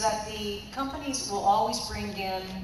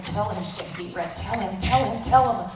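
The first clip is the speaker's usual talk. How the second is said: urging friend; 6 dB louder than usual. Retold or imitated. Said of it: "And my friend's going".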